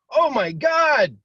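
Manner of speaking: high, loud, drawn-out "Oh my God!"; mock panic. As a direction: gasp it out slowly like the boss freaking out. Mind panics at the signal.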